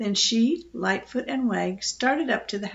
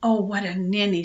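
ordinary delivery, nothing marked

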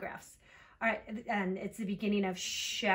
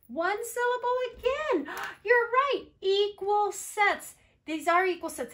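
high, loud delighted teacher; excited praise; 10 semitones higher, 4 dB louder than usual. Real, not acted. Teacher thrilled by equal sets, high and excited. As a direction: gasp and cheer the right answer. Teacher celebrates the class's answer.

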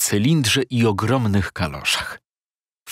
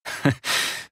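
quick airy laugh under the breath; unctuous little laugh; soft breathy chuckle; 4 dB quieter than usual. Acted. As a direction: chuckle softly before speaking. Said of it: "śmiejąc się".

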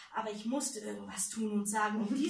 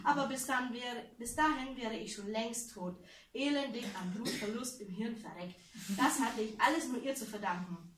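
usual speaking voice

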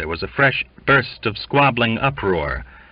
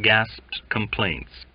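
plain reading voice

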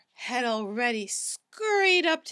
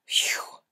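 whispered phew of relief; relieved whisper. Acted. Cats sigh with relief at the furnace, whispered phew. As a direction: breathe out relief.

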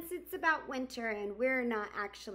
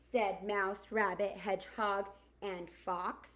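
gentle storybook narration; reading to children; 4 dB louder than usual. Acted. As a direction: read it like storytime for kids. Reads picture book aloud.

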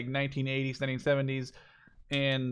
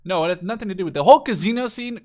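ordinary delivery

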